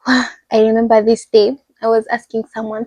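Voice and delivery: usual speaking voice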